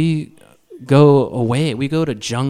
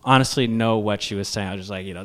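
usual speaking voice

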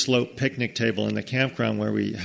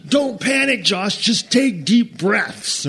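high, slow, laughing mock comfort; mocking boys; 8 semitones higher, 4 dB louder, slower than usual. Laughing boys taunting Josh through the outhouse door, high and slow. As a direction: tease him through the door with fake calm.

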